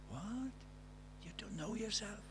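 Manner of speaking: the group's unspoken reaction, hushed and incredulous; hushed disbelief. Retold or imitated. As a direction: mutter it in quiet disbelief. Classmates silently disbelieving.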